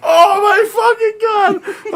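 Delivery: very high, loud, teary exclamation; crying with laughter. Real, not acted. Friend overcome by his own memory, crying with laughter. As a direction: wail it out, helpless from laughing. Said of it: "I'm crying right now".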